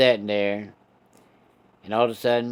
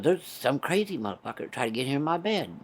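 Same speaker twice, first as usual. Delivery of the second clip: lightly voiced old woman, a bit higher and hushed; a hint of a grumpy old lady caught off guard; mild put-on granny voice complaining from bed; startled old mom; 4 semitones higher, 4 dB quieter than usual. Old mom woken in her bed.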